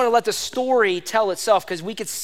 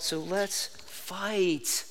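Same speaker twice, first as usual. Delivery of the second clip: hushed; 3 semitones lower, 12 dB quieter, much slower than usual. Real, not acted. Preacher drops to near whisper.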